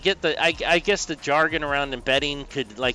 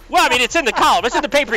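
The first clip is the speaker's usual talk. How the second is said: high, loud defensive retort; mock protest; 11 semitones higher, 7 dB louder, faster than usual. Retelling the exchange about the column, loud mock protest. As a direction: protest it in a raised, pleading pitch.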